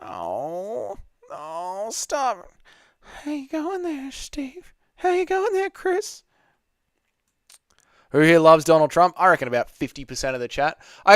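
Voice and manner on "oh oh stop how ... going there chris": soft, very high falsetto character voice; hushed falsetto impersonation; Steve Griffin. Acted. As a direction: put on a tiny, high cartoon voice. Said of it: "listen to Steve Griffin and have a go at it".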